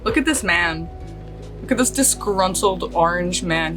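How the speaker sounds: ordinary talk, nothing marked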